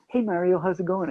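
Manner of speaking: bright, loud, high hello; cheery greeting. Retold or imitated. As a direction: greet him like an old pal.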